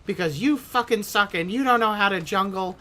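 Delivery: high, loud, scornful nagging; mock angry rant. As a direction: tear into him like an angry teammate. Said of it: "like, laying into me".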